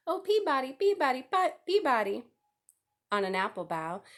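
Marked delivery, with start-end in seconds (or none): 0.1-2.3 high bright whistle of a bird call; birdcall. A narrator's reading. The sparrow calls from the edge of the woods, high birdcall. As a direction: sing it out like a sparrow calling.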